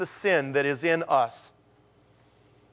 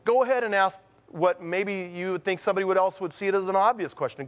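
ordinary talk

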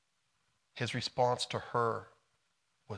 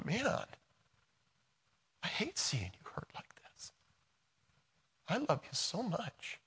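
softer, breaking into a whisper, close to tears; tearful tenderness; 10 semitones higher, 5 dB quieter than usual. Pretend. Jesus comforts the hurting believer, tearful whispered tenderness. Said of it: "He's there with the tears going".

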